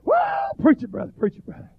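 high squealed "well" dropping into quick cheering; squealing amen-corner cheer, imitated. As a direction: mimic a squalling young preacher egging someone on. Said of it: "just a squalling and a squealing behind me".

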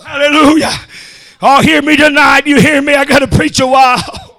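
shouted preacher's whoop, punching each line; preaching at full force. Acted. Preacher roaring at the congregation about the mule. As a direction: drive the sermon home, chanting the climax. Whooping sermon on fast mules.

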